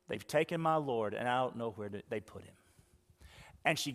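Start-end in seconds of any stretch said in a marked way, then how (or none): 0.1-2.5 grieving answer. Acted. Mary answers angels in grief.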